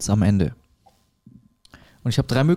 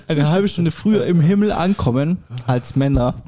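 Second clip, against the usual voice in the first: normal talking voice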